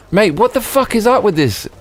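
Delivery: high-pitched, frustrated protest at normal volume; exasperated outburst. Real, not acted. Gamer complaining at a stubborn game, exasperated.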